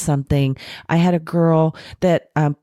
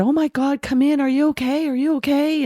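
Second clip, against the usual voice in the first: higher, alarmed mother's voice fussing over her daughter; frantic worried mom; 10 semitones higher than usual. Retold or imitated. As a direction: gasp it out like a frightened mom.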